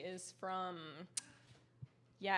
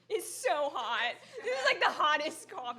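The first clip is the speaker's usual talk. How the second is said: louder, laughing about scalding coffee; real laughing complaint about hot coffee; 6 semitones higher, 10 dB louder than usual. Real, not acted. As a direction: laugh through it after a burning sip. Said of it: "Wow, it's so hot".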